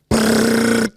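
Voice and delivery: high loud brrr rattle; machine gun sound. Retold or imitated. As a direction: imitate a machine gun, rolling it. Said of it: "her doing a machine gun sound".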